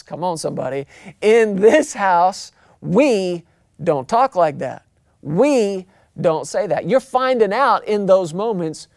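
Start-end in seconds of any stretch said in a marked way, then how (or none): 1.2-6.9 slow, emphatic house rule; stern parents. Acted. Mom and dad correcting the kid's language, slow and firm. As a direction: spell out the family law word by word. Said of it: "And they say".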